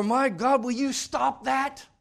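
hammed-up fed-up cry; exasperated outburst. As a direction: snap at someone to stop.

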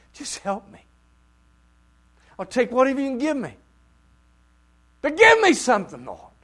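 loud, high, fast begging; desperate plea. Acted. Preacher voicing the woman begging the Lord for crumbs. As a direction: beg with everything you have.

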